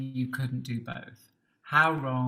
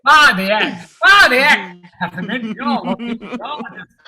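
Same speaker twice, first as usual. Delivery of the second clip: put-on northern English accent; 7 semitones higher, 15 dB louder than usual. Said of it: "really cold, warm accent".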